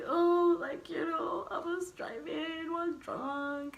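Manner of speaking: pitiful plea. Retold or imitated. To the older cellmates asking why she's here, a high teary plea for pity. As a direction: beg for pity so nobody hurts you. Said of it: "have pity for me".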